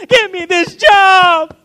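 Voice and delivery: high, loud, slow laughing demand; mock tantrum shout. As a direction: ham up a whiny child demanding it. Child demanding job, mock tantrum.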